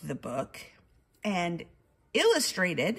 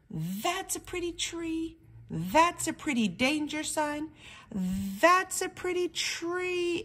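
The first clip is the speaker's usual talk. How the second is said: delighted bird voice; 8 semitones higher than usual. Acted. Wordy Birdie in woods.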